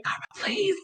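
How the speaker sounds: high desperate whispered plea; whisper yelling. Acted. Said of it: "desperately whisper screaming".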